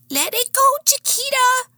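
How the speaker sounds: very high-pitched character voice; barking sidekick. A narrator's reading. Cuff hot on her heels, barking in high voice. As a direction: squeal a high, insistent warning. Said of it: "barking at me".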